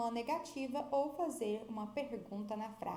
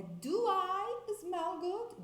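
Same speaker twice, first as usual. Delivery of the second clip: correct question; 8 semitones higher, 3 dB louder, much slower than usual. Shown as a demonstration. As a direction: do I smell good raised high and slow. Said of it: "Não, é".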